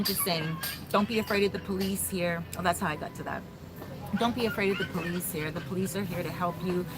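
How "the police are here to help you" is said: ordinary delivery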